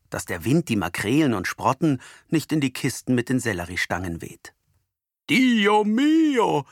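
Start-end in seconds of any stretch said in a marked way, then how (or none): 5.3-6.6 put-on Italian accent, high and loud; Italian exclamation. A narrator's reading. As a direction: cry out like an Italian grocer. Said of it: "denn Antonio ist Italiener". (italienischer Ausruf)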